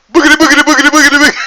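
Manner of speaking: high, loud nonsense yell; jump-scare babble. Retold or imitated. Prankster rounding the corner to scare his buddy, loud high babbling. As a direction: burst out with a loud, high-pitched scare cry. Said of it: "I turn the corner and I go".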